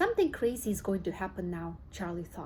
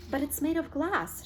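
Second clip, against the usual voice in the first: alarmed shout; 6 semitones higher than usual. Acted. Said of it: "shouted Grandpa Joe".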